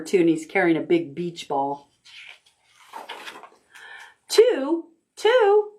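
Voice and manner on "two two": pig voice. Acted. Pig voice in picture book.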